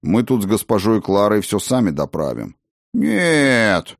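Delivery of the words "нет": high, indignant gnome voice; bristling little gnome protests. Acted. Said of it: "возмутился гном".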